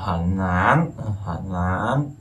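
"hẳn lán" drawn out, with lán gliding upward; curled third tone. Shown as a demonstration. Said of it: "uốn lưỡi như vậy".

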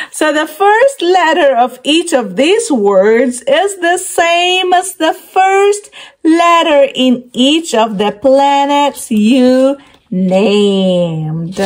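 ordinary talk

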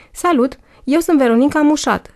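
a light, sugary chirp of a hello; cute chirp. A narrator's reading. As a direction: chirp it sweetly like a pageant girl. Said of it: "s-a auzit un ciripit drăgălaș".

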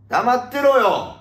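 high, loud, drawn-out order; mock angry shut-down. Host silencing critics of the fight, mock angry. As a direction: bellow it slowly like a tough guy.

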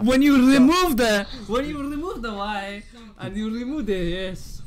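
put-on Indian accent. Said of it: "Why are you doing African accent?".

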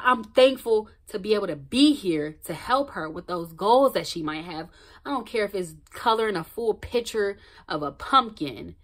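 ordinary talk

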